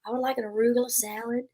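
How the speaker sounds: ordering a salad in a lightly done Texas voice; wife playing Holly Hunter ordering at a restaurant; Holly Hunter impression. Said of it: "You need to do Holly Hunter character".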